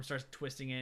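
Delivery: ordinary delivery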